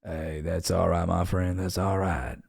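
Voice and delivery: low, slow, soft Southern drawl; Southern accent. Acted. Streamer answering a request for his best impression, Southern accent. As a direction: reassure a friend in a quiet Southern drawl. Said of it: "best Southern accent or impression".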